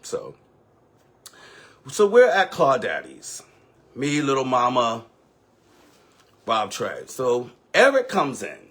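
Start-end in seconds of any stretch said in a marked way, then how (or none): none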